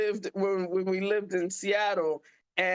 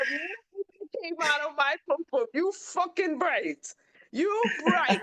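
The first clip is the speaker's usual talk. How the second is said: high, laughing through the punchline; sassy and amused, breaking into a laugh; laughing mock outrage; 9 semitones higher than usual. Mom repeating her comeback to the listener, high and laughing.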